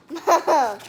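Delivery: loud laughing call to mom. Real, not acted. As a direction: giggle her name out. Kid laughing calls mom.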